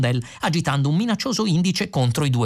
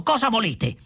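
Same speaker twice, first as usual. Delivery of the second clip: high-pitched challenge; irritated sharp question; angry demand; 8 semitones higher than usual. A narrator's reading. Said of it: "tutto arrabbiato".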